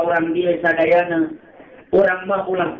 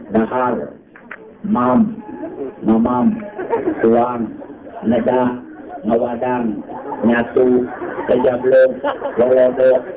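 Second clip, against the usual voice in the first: listing words; 6 semitones lower, slower than usual. Shown as a demonstration. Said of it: "Nyebut dahar ge loba di Sunda".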